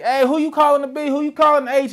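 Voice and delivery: high, fast indignant women's voices; offended women. Retold or imitated. Women wilding out at party.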